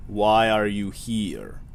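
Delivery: very slow, put-on Russian accent; faux Russian accent. Towering clerk questioning visitor, slow faux Russian accent. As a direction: stretch it out in a fake Russian accent. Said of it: "a, faux Russian accent".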